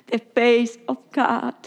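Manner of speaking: drawn out, slow and deliberate. Acted. Preacher lingering on the phrase for the congregation, slow. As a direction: let each word land slowly. Third slower repeat.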